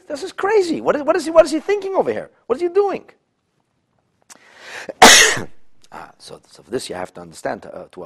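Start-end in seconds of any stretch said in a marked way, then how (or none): 0.1-3.0 much higher, incredulous exclaiming; outraged disbelief. Real, not acted. Teacher to class, high outraged disbelief. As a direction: throw up your hands at the absurdity.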